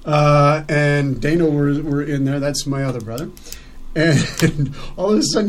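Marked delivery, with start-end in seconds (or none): none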